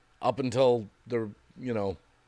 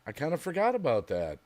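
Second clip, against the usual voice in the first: ordinary talk, nothing marked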